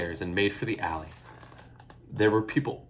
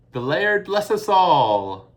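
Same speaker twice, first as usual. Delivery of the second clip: usual speaking voice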